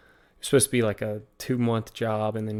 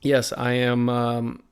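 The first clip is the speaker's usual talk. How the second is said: usual speaking voice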